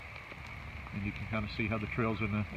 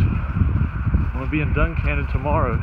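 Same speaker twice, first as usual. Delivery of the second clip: talking over wind; 8 dB louder than usual. Real, not acted. Loud wind or rain noise.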